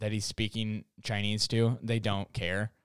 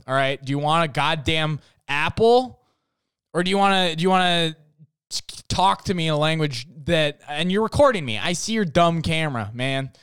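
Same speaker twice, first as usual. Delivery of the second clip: raised, louder exasperated rant; mock freak-out; 4 semitones higher, 5 dB louder than usual. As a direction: act out a fed-up fruit seller losing patience. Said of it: "I would just freak out at him".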